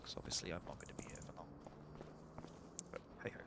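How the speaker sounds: unvoiced confiding aside; whispered aside. Real, not acted. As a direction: drop to a whisper.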